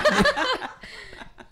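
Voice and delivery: loud, high, rising laugh; high burst of laughter. Real, not acted. End of funny story.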